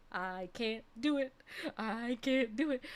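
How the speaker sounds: soft, small self-doubt; defeated inner thought. Retold or imitated. Patient alone with urine sample cup, quietly defeated. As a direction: say it softly to yourself, giving up.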